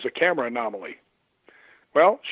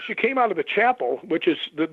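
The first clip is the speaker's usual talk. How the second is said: ordinary talk, nothing marked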